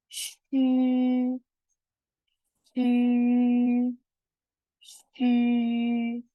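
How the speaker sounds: shu with a front rounded ü, held long and level; sustained model sound. Shown as a demonstration. Teacher correcting a reading of cu, long level shu. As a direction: hold shu high and level with rounded lips. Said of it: "Ini kita baca".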